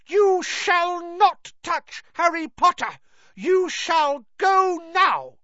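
loud, high elf warning, slowing at the end; defiant high-pitched elf shout; fierce Dobby. A narrator's reading. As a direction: command fiercely in a high creature voice. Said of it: "he said fiercely".

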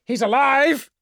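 high, loud urgent shout. Acted. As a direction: yell for help across the room. Jaralek calls for the apothecary.

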